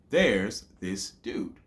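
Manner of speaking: There's, this, dude, each slowly set apart; measured gaps through There's this dude; syllable by syllable. Shown as a demonstration. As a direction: sound out There's this dude one beat at a time. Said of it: "break that down into syllables".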